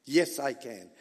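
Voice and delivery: normal talking voice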